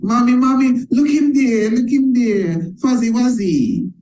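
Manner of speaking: put-on high, pleading little kid voice; a child tugging at mom in the store; child begging parent. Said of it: "the children and the parents are in the supermarket".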